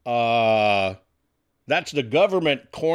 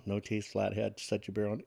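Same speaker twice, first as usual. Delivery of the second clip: quiet aside; 7 semitones lower, 13 dB quieter than usual. Real, not acted. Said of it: "finally getting that joke now".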